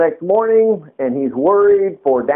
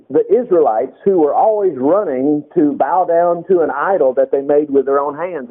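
ordinary talk, nothing marked

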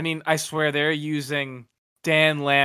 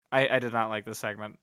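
normal talking voice

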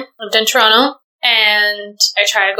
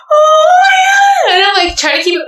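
crying; 4 dB louder than usual. Real, not acted. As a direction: fight through real tears. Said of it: "I'm crying right now".

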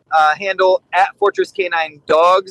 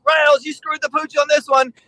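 high mock commander verdict; hint of a gruff boss; 9 semitones higher than usual. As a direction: commander calling out a mistake. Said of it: "my commander would be like".

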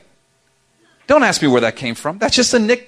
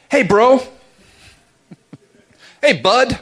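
bright, higher mock bro greeting; mock friendly greeting; 8 semitones higher, 4 dB louder than usual. Preacher mimicking someone who forgot your name, mock bro greeting. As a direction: cover a forgotten name with breezy cheer. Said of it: "when someone forgets your name".

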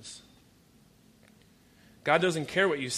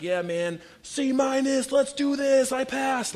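much higher, loud dude shout; cheering a bare pass, high and loud; mock slacker cheer; 12 semitones higher, 7 dB louder, slower than usual. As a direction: hype it up like a happy C-minus student. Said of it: "I was the guy that's like".

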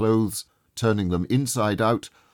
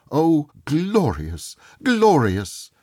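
high, slow, rapturous; exultant; 6 semitones higher, 5 dB louder, slower than usual. A narrator's reading. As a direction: savour the word in rapture. Scrooge exulting at the window.

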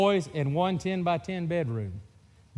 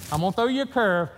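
louder and higher sly promise; mock promise; 4 semitones higher, 3 dB louder, faster than usual. To brother before the fastball, louder sly promise. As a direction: lean into it as a fake assurance. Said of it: "I said".

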